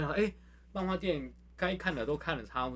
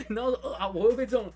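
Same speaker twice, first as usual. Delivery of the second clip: ordinary talk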